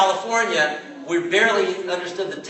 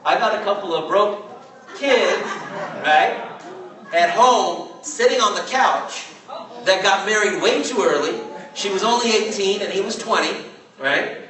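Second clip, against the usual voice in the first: laughing; 5 semitones higher than usual. Retold or imitated. Friend describing broke kids, laughing.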